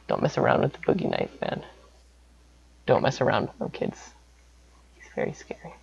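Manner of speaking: very soft, near-whispered caution; hushed spooky warning. Acted. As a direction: drop to a whisper to warn the kids. Hushed spooky closing warning to kids.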